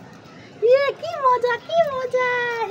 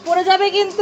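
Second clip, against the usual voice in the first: usual speaking voice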